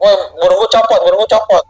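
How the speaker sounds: high, loud cry of panic; shouting that something has been torn off; retold panicked yell. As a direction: yell it like a man in terror. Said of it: "dia pas dia teriak".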